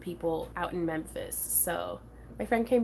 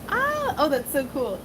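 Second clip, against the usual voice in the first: excited squeal; 5 semitones higher, 6 dB louder than usual. Real, not acted. Delighted by Klingon bunny costume.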